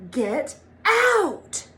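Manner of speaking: sharp high angry shout; angry dad. A narrator's reading. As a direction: throw him out of the office. Dad angry at interrupting son.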